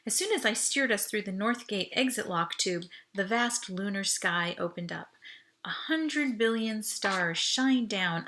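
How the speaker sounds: ordinary delivery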